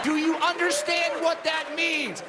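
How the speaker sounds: ordinary talk, nothing marked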